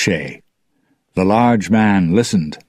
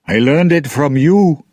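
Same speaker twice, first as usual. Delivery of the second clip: raised, higher-pitched retort; crying out; 8 semitones higher, 4 dB louder than usual. A narrator's reading. Jones to the lover he blames, crying out. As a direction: throw the blame back in an outcry. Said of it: "Jones cried".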